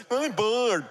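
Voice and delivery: high, mocking child's whine; whiny bored kid. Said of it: "one of my kids goes".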